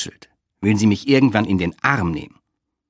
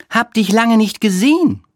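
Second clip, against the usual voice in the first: high, louder voice for a kiosk friend; Olga; 12 semitones higher, 5 dB louder than usual. A narrator's reading. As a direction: greet him in a high-pitched character voice. Olga greets her friend at kiosk.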